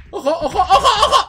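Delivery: high, loud, climbing repeats; alarmed warning. Real, not acted. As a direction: shout a panicky watch-out. Alarma repentina en el juego. (aviso alarmado, agudo y creciente)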